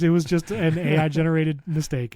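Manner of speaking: usual speaking voice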